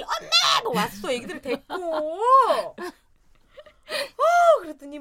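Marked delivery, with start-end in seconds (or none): 0.0-0.6 put-on high, loud child's call; kids calling. Kids at the door calling for their mother, high.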